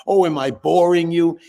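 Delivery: tossed off like a miffed lecturer; mildly sarcastic put-down; mock offended. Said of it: "It's not polite".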